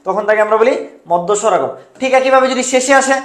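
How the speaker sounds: usual speaking voice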